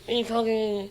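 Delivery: high, rowdy put-on yell; lairy young ned. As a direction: play a mouthy street kid. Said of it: "Not that I ran about saying".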